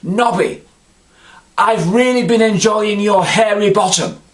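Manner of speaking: high, loud call across a crowded bar; shouting across the pub. Retold or imitated. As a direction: yell it across the room to a friend. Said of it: "I shouted to him across the bar".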